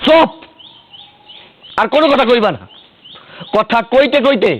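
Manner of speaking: quarrelling husband losing patience; angry silencing. Acted. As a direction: cut the wife off angrily.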